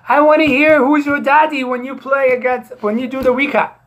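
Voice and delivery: high, loud mocking quote of the caller; retold demand. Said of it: "He even called the commish".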